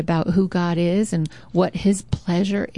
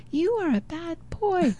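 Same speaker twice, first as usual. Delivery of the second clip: the insult comes back much softer; faint echo; 9 semitones higher, 6 dB quieter than usual. Retold or imitated. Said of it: "So he's hearing the echo".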